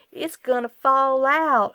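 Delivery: high, loud, slow drawn-out warning; imitating grocery packer. Wife imitates the grocery packer to viewers, high and slow. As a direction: mimic the fussy bagger's voice. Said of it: "that's exactly how he sounded".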